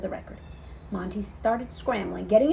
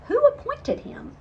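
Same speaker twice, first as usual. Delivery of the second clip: kitten voice. A narrator's reading. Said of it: "She wondered".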